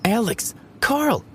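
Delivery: high-pitched excited call to friends; excitedly urging friends to hurry; excited shout. A narrator's reading. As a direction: call them over urgently, pitch leaping up. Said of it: "Ben shouted excitedly".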